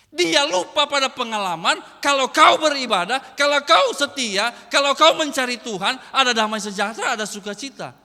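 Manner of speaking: loud, driving sermon delivery with a punch on each kau; pounding out kalau kau like a revival preacher; full-force preaching. Acted. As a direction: preach it hard, building the rhythm.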